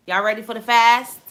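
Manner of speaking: loud, energetic hype call to the audience; rally the viewers like a hype host; hyped call-out. Real, not acted. Host hyping up her viewers with a loud call. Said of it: "host calling out to viewers".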